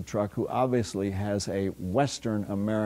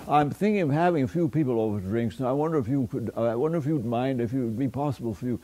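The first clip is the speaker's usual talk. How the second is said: quick aristocratic stammering hedge, slightly higher; indirect, hedging invitation; 3 semitones higher, faster than usual. Shown as a demonstration. Lecturer mimicking Oxford aristocrats inviting people for drinks, hesitant and indirect. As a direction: dither politely round the invitation, restarting as you go. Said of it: "a certain sort of indirection in conversation".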